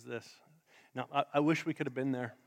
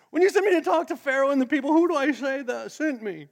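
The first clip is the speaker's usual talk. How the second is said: higher, fast, jittery Moses asking God; nervous high Moses; 9 semitones higher, 5 dB louder than usual. Retold or imitated. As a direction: rush it out, high and anxious. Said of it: "Moses had a high-pitched voice like that 'cause he was nervous".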